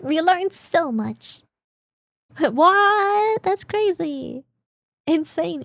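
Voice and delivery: high, drawn-out sarcastic awe; mock amazement. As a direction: gush with exaggerated fake astonishment. Sarcastic awe at an obvious fact.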